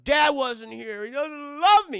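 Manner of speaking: high, loud, slow whine; hammed-up sulking cry; mock sobbing whine. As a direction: whine it like a heartbroken kid. Victor upset about dad.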